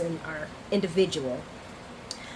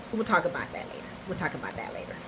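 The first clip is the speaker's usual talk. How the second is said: dropped aside; 4 semitones lower, 8 dB quieter, much faster than usual. Real, not acted. Cuts herself off, repeats aside.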